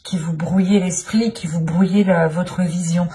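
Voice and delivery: usual speaking voice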